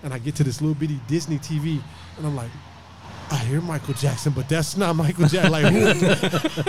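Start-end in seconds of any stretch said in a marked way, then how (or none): 3.3-6.6 put-on high, laughing disbelief as a seven-year-old; a kid who can't place the voice, higher and louder; mock confused kid, cracking up; puzzled kid. Kid hearing fake [name].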